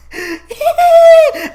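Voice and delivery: high, loud burst of laughter; disbelieving laugh. Real, not acted. Laughing at the movie's fans, high loud laugh. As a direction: crack up at how dumb it is.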